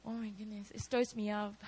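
ordinary talk, nothing marked

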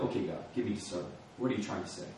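soft, fast prayer under the breath; quiet, hurried plea to God; hushed quick prayer. Retold or imitated. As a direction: ask God quickly and softly.

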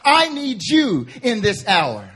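high, loud, urgent preaching of God's plea; full-force preaching. Acted. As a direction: preach it as a cry to the congregation.

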